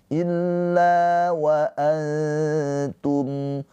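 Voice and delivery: very slow, sustained chant; tilawah Al-Qur'an. Shown as a demonstration. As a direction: recite with long held vowels and nasals. (tilawah sangat pelan, bacaan panjang ditahan)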